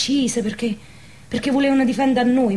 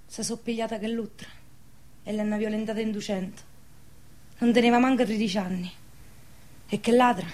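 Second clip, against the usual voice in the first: hushed testimony; 8 dB quieter than usual. Acted. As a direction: tell it quietly, with restraint. Hushed testimony of wartime atrocities.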